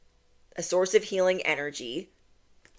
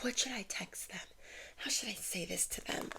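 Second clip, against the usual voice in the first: breathless conspiratorial whisper, much softer; scheming whisper; 18 dB quieter than usual. Retold or imitated. Voicing the meddlers fretting over what to text you, in a secretive whisper. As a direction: whisper it like plotting behind someone's back.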